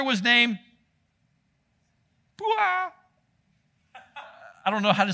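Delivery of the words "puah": Puah sung up high and stretched; exaggerated name. Shown as a demonstration. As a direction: overplay Puah with a high swoop. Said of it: "I may have exaggerated that a little bit".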